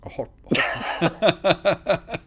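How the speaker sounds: breathy laughing half-whisper; giggling through the words. Real, not acted. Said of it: "putting a hot potato in your mouth".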